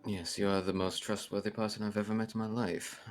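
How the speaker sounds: soft, understated compliment that sounds mocking; faintly dubious praise; accidentally sarcastic. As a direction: praise them quietly, as if needling. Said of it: "sound like I'm giving her shit".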